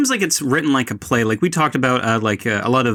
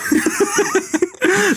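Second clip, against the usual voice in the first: high, helpless laugh; high laughter. Real, not acted. Critic cracking up after her parody, high laugh. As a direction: dissolve into laughter.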